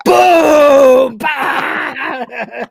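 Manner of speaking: a loud gasp, then a high "Boom! Bang!"; shouted explosion noises. Pretend. Describing Crichton's explosion, shouted boom and bang sound effects. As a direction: yell the blasts like a kid playing.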